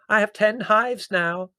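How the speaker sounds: high, loud, slow boast; proud. A narrator's reading. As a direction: announce it proudly as the deaf old aunt. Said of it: "said Aunt Fan proudly".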